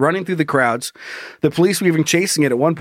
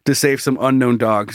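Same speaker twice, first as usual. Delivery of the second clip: normal talking voice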